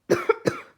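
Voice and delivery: hammed-up loud cough; coughing fit. The Lorax coughs at the Onceler, hammed-up hacking. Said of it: "He coughed and he whiffed".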